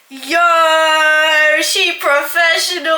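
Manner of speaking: loud, drawn-out hype call; mock triumph. Baker celebrating a great egg crack, mock triumph. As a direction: cheer yourself on loudly.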